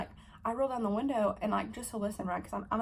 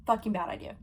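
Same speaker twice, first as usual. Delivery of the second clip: ordinary talk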